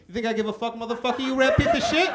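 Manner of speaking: high, lisping boxer outburst; hammed-up high, lisping tirade; Mike Tyson. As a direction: lisp it high and rising in mock outrage. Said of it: "Mike goes".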